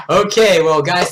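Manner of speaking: ordinary delivery, nothing marked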